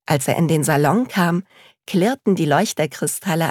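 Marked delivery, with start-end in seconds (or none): none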